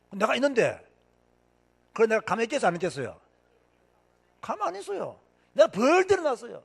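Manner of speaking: ordinary delivery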